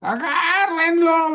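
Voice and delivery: high, loud frantic yell; high crying shout; panicked shout. Acted. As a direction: scream for someone to grab him. Thomas and John shouting to grab him.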